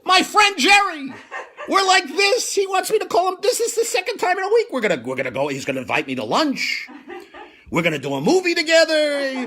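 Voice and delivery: high, loud, dreamy fanboy gushing; mock starstruck daydream; giddy inner voice. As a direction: swoon over your new best friend. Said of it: "Now I'm thinking".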